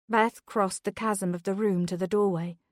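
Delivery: usual speaking voice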